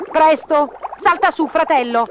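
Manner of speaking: high, urgent rescue cry; urgent call. A narrator's reading. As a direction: urge someone to jump on fast. Cat urgently rescues drowning brother.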